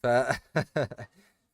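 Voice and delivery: ordinary talk, nothing marked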